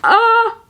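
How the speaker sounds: high sudden shriek of disgust; startled shriek. Real, not acted. Reader shrieks at a gross birth scene, sharp shriek. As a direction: shriek out as if something grabbed you.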